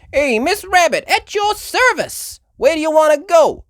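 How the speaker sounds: high, loud New York cabbie voice; brash friendly driver. Acted.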